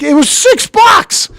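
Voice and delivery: high, slow, loud outraged yell; indignant shout. Retold or imitated. Complaining the empty box cost six bucks, shouting. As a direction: shout the price in disbelief.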